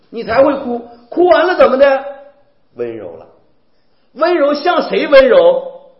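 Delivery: rising, fervent sermon cadence; preaching at full force. Acted. Preacher urging the congregation on the Beatitudes, loud and fervent.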